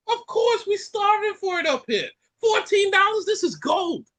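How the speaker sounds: high-pitched mock indignant protest; mock Jamaican relatives. Mocking Jamaicans who crave ackee, high indignant protest. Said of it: "I got Jamaicans that I've seen".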